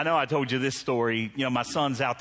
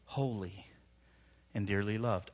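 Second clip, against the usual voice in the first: much softer and lower on holy and dearly loved; hushed emphasis; 7 semitones lower, 7 dB quieter than usual. Real, not acted. Preacher letting the key words sink in, hushed. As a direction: drop the voice to nearly nothing on holy.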